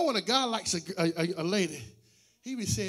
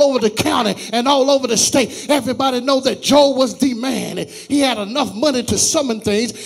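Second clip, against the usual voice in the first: preaching at full force; 13 dB louder than usual. Acted. Pastor preaching loudly about Job's faith and Satan's limits. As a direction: preach it to the whole church at full volume.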